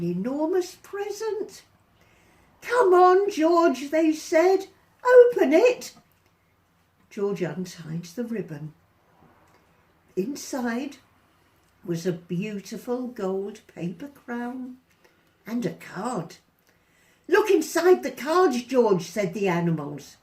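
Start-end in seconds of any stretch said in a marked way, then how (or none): 2.6-5.9 much higher, louder urging; eager animals. Acted. Animals urging George to open the present, eager. 17.3-19.0 much higher, louder urging; eager animals. Acted. Animals urging George to open the present, eager.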